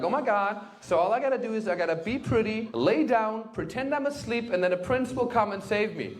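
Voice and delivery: put-on naive girl. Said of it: "they're gonna be like".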